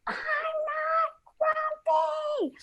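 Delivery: very high, drawn-out strained mock yell. Grumpy monkey finally snaps at his friends, mock yelling. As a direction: stretch each word into a held-back storybook scream. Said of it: "that I yelled at my friends".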